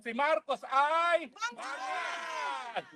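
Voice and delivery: slow, drawn-out taunt; taunting cry. Acted. Rally speaker taunting Marcos, slow mocking call. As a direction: drag it out to mock him.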